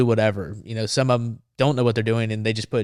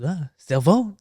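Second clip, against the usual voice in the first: high, rising mock-French question; bad French accent; 7 semitones higher, slower than usual. French reporter asked to lend his phone, bad French accent. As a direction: imitate a puzzled Frenchman. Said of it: "if it's a bad French accent".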